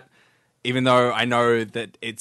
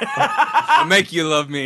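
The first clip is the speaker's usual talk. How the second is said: mock menacing; 5 semitones higher, 4 dB louder, slower than usual. Mock converting a friend.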